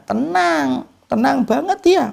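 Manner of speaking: high, drawn-out answer; high, emphatic call of tenang. Real, not acted. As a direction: sing out the answer to the crowd. Preacher answers own question emphatically.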